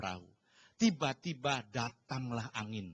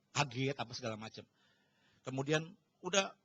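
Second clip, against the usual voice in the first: ordinary talk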